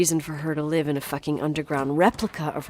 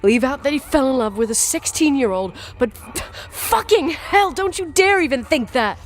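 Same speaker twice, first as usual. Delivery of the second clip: furious shout; 7 semitones higher, 4 dB louder than usual. Acted. Daughter defends father, screaming.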